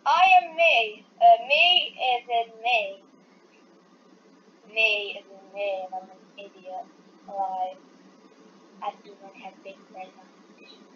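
ordinary delivery

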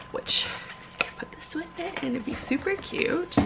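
soft, unvoiced whisper; hushed, delighted whisper. Real, not acted. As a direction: whisper it like a secret. Whispering about pacifier pocket.

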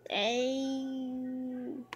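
ordinary delivery

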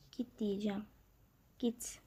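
hushed unvoiced whisper. Real, not acted. Whispering an aside to her viewers. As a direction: whisper it so nobody hears.

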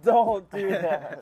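loud laughing plea, slow. Real, not acted. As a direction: beg through laughter, stretched out. Pleading through laughter, nearly sick.

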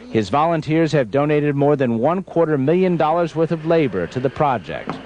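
measured news-report narration; Reportage-Sprecher. Acted. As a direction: read it as a scripted TV feature voice-over. Reads a recorded news report.

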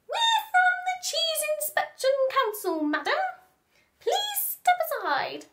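high, loud squeaky official; officious mouse. Acted. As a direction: politely dismiss her.

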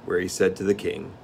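ordinary delivery, nothing marked

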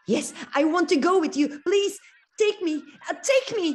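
high, loud, rushing plea; eager pleading. Acted. Thumbelina begs the swallow to take her, high and urgent. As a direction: beg to be taken away.

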